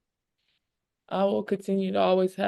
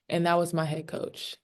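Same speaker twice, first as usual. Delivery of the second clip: ordinary talk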